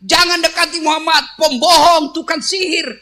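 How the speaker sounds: high, loud street yelling; a blind man in the market yelling warnings to passers-by; shouting blind man. Acted. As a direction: rail at the crowd to stay away. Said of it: "yang teriak-teriak".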